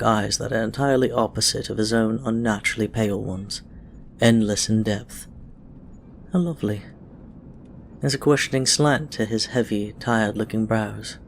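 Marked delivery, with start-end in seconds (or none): none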